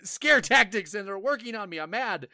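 high, slow exasperated outburst at the game; cursing a cheap horror game, hammed-up fury; mock angry rant. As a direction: rant it like you got jump-scared. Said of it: "And I was like".

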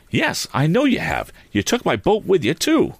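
high-pitched, indignant; heated young hero; angry accusation. A narrator's reading. Tom accuses Andy.

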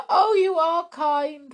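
high, loud, tearful gratitude; weeping thanks. Acted. Waffles to Pointy, tearful after being forgiven. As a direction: cry it out with relief. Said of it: "wept Waffles".

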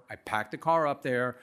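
normal talking voice

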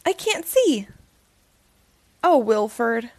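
lively read-aloud storytelling voice; story narration. A narrator's reading. Storyteller reading a Halloween book to children.

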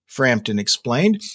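usual speaking voice

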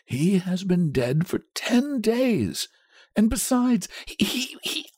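high, halting, dread-filled; shaking voice. A narrator's reading. Old man warns wife about the mangled body, voice shaking. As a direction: falter over what you dread to tell. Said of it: "his voice shook".

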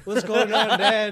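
put-on high, loud kid through laughter; hammed-up bewildered child; mock confused child. Kid asks dad what's going on, mock confusion.

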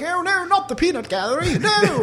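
high wailing butler voice; panicked butlers. Acted. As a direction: wail it like frightened staff. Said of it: "they're like".

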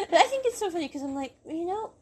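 ordinary talk

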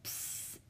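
short soft hiss; a quick unvoiced psst; baby's psst noise. A narrator's reading. Baby fusses before crying, soft psst. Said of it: "It said".

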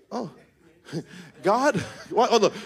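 normal talking voice

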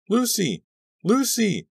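very high frantic cry; piercing call; shrieking. A narrator's reading. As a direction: scream her name down to her. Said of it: "I was shrieking her name".

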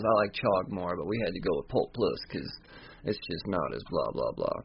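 a lightly voiced low villain fading out; low mumbling Montero. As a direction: voice drops low and trails off.

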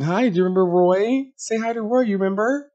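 mother nudging her daughter to greet Roy, sugary; sing-song mom voice. Retold or imitated. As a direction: sweetly prompt the little girl. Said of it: "Kenya says to Brooklyn".

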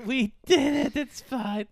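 high, laughing mock triumph; laughing cheer. Co-host mocks the heroes' victory, laughing. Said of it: "mock cheer".

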